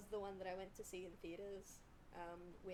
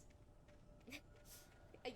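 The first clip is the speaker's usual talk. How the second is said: soft, breath-heavy laugh; 2 semitones higher, 12 dB quieter than usual. Real, not acted. Streamer amused by chat, quiet laugh.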